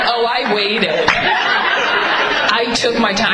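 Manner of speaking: very slow, savoured delivery; imitated cool menace. Friend recalls waiting to confront cheater, slow and cool. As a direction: stretch it out, coolly imitating her. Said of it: "You were a stone cold bitch".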